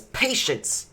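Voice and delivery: loud, high angry snap; indignant outburst. Acted. Drow throwing the Webmother's word back, angry. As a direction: throw Patience back at her. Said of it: "And he says".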